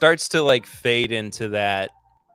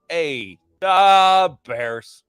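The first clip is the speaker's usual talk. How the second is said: loud Chicago "Da Bears" shout; Chicago Superfan accent; 5 semitones higher, 7 dB louder, much slower than usual. Retold or imitated. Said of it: "it took me a minute to get into it".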